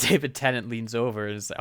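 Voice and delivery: usual speaking voice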